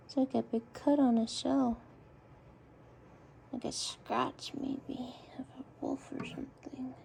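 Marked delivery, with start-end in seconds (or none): 3.5-6.9 whispered aside, voice off. Real, not acted. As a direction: breathe it under your breath to the camera. Quiet aside to camera.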